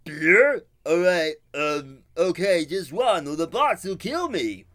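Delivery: high, loud dim-witted guard voice; eager simpleton tempted by beer; dopey guard voice. Retold or imitated. Guard jumping at a free beer, high and loud. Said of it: "the guard was like".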